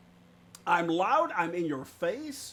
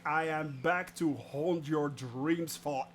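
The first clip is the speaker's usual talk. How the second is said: haunt them like a cartoon villain; hammed-up ghostly threat; mock menace; 4 semitones lower than usual.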